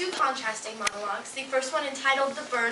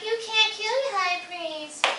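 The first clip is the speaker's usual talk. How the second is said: slow, drawn-out, higher-pitched reprimand; mock scolding dad; 9 semitones higher, slower than usual. Goddess imitating dad forbidding killing priests, mock scolding. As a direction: draw out each word as a parent's rule.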